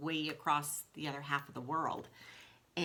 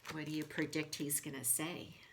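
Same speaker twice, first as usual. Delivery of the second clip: ordinary delivery, nothing marked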